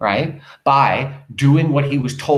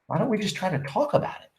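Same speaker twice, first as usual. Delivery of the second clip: soft plaintive appeal, lifting higher; 5 semitones higher, 7 dB quieter than usual. Acted. Wife asking husband to talk, softly plaintive. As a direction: plead softly, understated.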